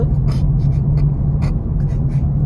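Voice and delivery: demonstrated breathless sobbing gasps, loud; loud put-on gasping cry; choking cry. Shown as a demonstration. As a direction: choke on your mock sobs. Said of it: "about to choke type of crier".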